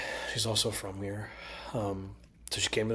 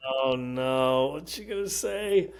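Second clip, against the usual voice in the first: slow, higher-pitched comic worry; mock dread; 4 semitones higher, 4 dB louder, much slower than usual. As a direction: replay an oh-no moment, drawn out. Retold dread of her verdict.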